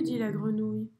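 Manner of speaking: ordinary delivery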